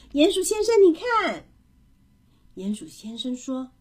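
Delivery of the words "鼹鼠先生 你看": put-on high, loud child's call. A narrator's reading. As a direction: call out to show something off. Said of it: "他大声说". (高声喊人来看)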